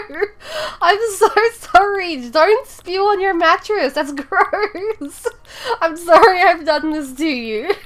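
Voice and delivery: high, loud giggly sorry; real laughing apology, high and loud. Real, not acted. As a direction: squeal it out through laughter. Laughing apology after spitting story.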